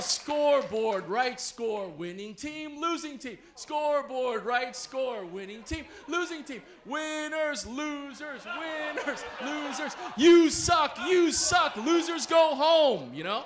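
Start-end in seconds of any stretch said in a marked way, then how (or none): 0.0-10.0 taunting chant in alternating calls; cheerleader yell. Retold or imitated. Cheerleaders taunt the losing team, imitated yelled chant. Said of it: "And then they would yell". 10.2-13.1 high, very loud taunting shout; jeering yell. Retold or imitated. Crowd jeers the losing visitors, high shouted chant. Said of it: "And then they would yell".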